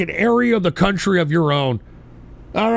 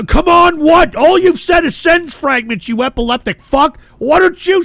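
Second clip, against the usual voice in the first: higher and louder, yelling him down; heated yelling at the caller; angry shout; 7 semitones higher, 5 dB louder than usual. Real, not acted. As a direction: berate the caller at full voice.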